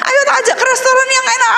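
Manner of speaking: high, very fast coaxing with a laugh; coaxing father. Retold or imitated. Father offering a good restaurant, fast high coaxing. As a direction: rush the offer like a pleading dad.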